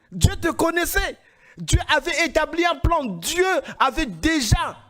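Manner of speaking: ordinary talk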